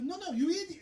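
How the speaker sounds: high, loud mock exasperated clerk; pushing harder each time; hammed-up exasperated cashier. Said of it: "She goes".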